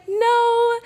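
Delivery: very high, loud drawn-out no; imitated refusal. Mentor refusing, acted out.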